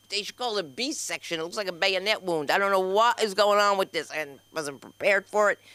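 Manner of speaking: usual speaking voice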